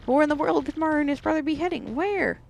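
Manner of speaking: a sneering schemer thinking aloud; high villain voice. Acted. Villain wonders where Mario goes.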